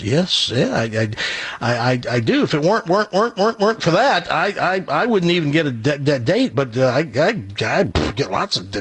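heavy stutter, repeating first sounds. Acted. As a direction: stammer through every word. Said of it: "This guy has a stuttering problem".

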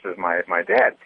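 usual speaking voice

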